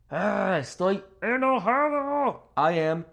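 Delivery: high, drawn-out angry groan into words; acted anger. Teacher demonstrates 'enojado', acted anger.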